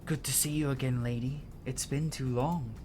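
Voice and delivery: ordinary talk, nothing marked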